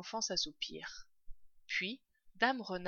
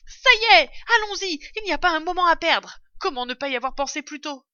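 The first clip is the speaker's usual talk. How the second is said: loud, high, fast burst; excited outcry; 8 semitones higher, 12 dB louder, faster than usual. A narrator's reading. Electrified father fox has an idea, crying out excitedly. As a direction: shout as an idea strikes, rushing on. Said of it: "Il fit un bond et s'écria".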